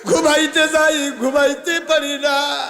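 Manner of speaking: loud, high melodic preaching; full-force sermon. Acted. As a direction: chant it out to the crowd, drawing out line ends. Preacher scolds women in crowd.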